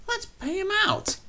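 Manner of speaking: high, nasty plotting coaches; spiteful coaches. A narrator's reading. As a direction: plot revenge, shrill.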